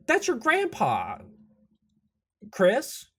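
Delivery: high, strained exclamation; spring the reveal at a high pitch; big reveal. Acted. Frog reveals the grandpa to Chris, excitedly.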